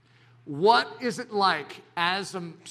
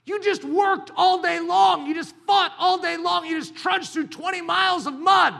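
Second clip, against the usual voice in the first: loud; 11 semitones higher, 7 dB louder than usual. Acted. Voicing the weary soldier's hardship.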